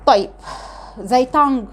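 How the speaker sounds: ordinary delivery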